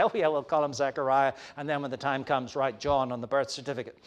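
quick, low-key mock agreement; passive-aggressive. Elizabeth imagined fobbing off relatives, mock passive-aggressive. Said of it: "kind of passive-aggressive and say".

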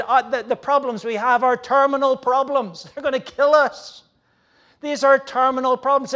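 loud, high mock despair over terminal problems; despairing Israelites. Preacher voicing Israel's hopeless complaint, loud mock despair. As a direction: ham up the hopeless grumbling. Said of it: "the people of Israel, uh, said".

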